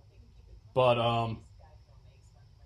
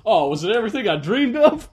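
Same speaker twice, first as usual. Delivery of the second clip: higher, louder dreamy gushing; hammed-up starstruck sigh of wonder; swooning fanboy; 8 semitones higher, 7 dB louder than usual. Retelling the character asking about the date, mock dreamy. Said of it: "including Neil Patrick Harris's character, go".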